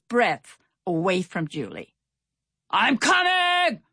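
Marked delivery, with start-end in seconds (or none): 2.7-3.8 high, drawn-out sailor's holler; calling out. A narrator's reading. Stout Sam hails his friends in the sea, calling out. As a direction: call out long and high across the water. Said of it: "Stout Sam called".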